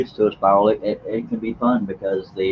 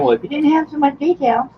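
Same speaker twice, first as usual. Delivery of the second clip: high mock nitpicker voice, much higher; mock critic voice. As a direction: squeak it out like a fussy critic. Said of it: "somebody else probably saying".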